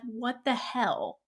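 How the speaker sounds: soft, slow, deflated; stunned disbelief. Retold or imitated. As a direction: let it fall out of you as everything collapses. Abigail's world crumbling.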